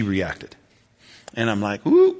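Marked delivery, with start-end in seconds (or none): 1.9-2.2 high-pitched startled "ooh"; a sudden high coo of mild alarm; taken aback. Retold or imitated. Pastor noticing a woman react to his topic, taken aback.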